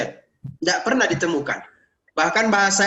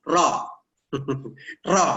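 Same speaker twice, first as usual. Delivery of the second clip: ordinary talk, nothing marked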